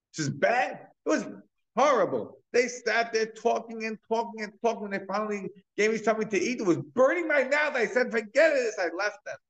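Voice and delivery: high, loud, indignant rant about the meal; angry beggar complaining. Retold or imitated. Beggar rants to his friend about bad food, high and loud. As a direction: spit it out like a furious, hungry beggar. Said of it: "he's so angry".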